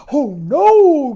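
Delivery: loud, high gasp; a hammed-up frightened gasp. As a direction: gasp in alarm. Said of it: "gasped Buddy".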